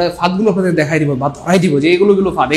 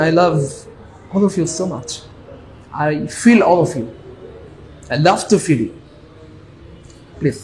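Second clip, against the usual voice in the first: slow, soft and tender, drawing out each word; tender, heartfelt; 4 dB quieter, much slower than usual. Acted. Teacher telling students he loves them, slow and tender. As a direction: melt a little as you say it.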